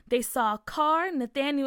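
usual speaking voice

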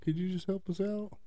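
high, whiny imitation of a begging scammer; hammed-up pleading; mock pleading scammer. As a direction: whine it up high, fake hungry. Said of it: "he's like".

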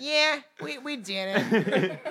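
hammed-up New York accent, slow and laughing. Mocking the killer's claim to friends, New York accent. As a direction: drag out a New York tough-girl boast. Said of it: "You gotta have, like, a New York accent".

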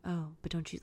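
very soft retold interjection; quiet background murmur. Man by the fireplace gently interjecting, hushed. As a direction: say it softly from the sidelines. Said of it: "just kinda being like".

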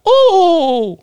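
high-pitched cartoon "ooh"; weird Mario noise. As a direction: squeal a long game-character whoop. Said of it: "weird ass fucking noises".

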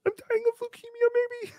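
very high, hurried pleading squeak; put-on poor-me whimper; mock pitiful. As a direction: plead in a hammed-up tiny high voice. Mock plea for donations.

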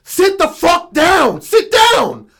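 a father roaring at his flailing son to sit down; full-throated enraged bellow; furious shout. Retold or imitated. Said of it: "He's like".

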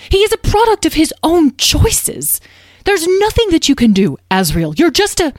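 agitated mother, high and loud, insistent; panicky. A narrator's reading. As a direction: forbid it urgently, breaking off mid-thought. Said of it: "Beatrice's face looked panicky".

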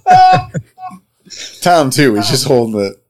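talking through laughter; words breaking into laughs; laughing. Real, not acted. Group cracking up.

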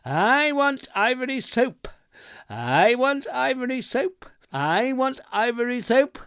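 loud, high, jubilant repeated cry; top-of-lungs shout. A narrator's reading. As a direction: yell it like a chant, pitch right up. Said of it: "shouted at the top of my lungs".

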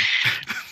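short burst of laughter; sudden breathy laugh; real laugh. Real, not acted. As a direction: crack up briefly. Laughs after failed solemn moment.

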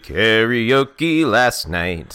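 loud, high and drawn-out; exuberant outburst. Real, not acted. Cohost blames last night's karaoke, exuberant and drawn-out. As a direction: stretch it out big and loud.

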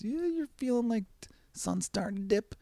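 floating way up, wistful; dreamy high voice. Real, not acted. Picturing a summer evening walk in a dreamy high voice. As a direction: lift it up as the sun dips.

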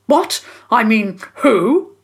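high, loud, flustered splutter. Acted. Guilty man caught about the dog, spluttering. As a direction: caught out, sputter the denial. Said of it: "and he spluttered".

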